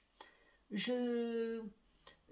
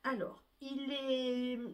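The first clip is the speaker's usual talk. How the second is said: normal talking voice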